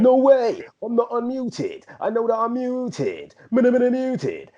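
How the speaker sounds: high, drawn-out garage MC chant. Retold or imitated. Yoga student unaware he's unmuted, garage MC voice. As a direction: stretch it out like an MC on the mic. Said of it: "I do it with the M C voice".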